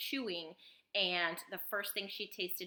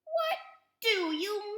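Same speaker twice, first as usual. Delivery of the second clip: loud, high-pitched and drawn out; indignant girl, high and slow; high child's question; 6 dB louder, slower than usual. A narrator's reading. Violet answers her screaming parents.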